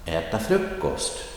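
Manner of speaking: äta short, frukost stressed; phrase stress. Shown as a demonstration. Teacher modelling the last example. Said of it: "The äta is short".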